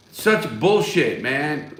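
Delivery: ordinary delivery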